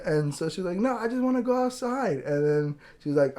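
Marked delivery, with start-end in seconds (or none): 0.8-2.2 put-on higher, louder child protesting; voiced insistent daughter. Daughter tells mom she wants to go outside, insistent. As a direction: imitate a kid pushing back on mom. Daughter on phone pleading with mother.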